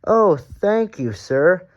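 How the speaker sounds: happily. A narrator's reading. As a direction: beam with delight. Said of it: "said Thomas happily".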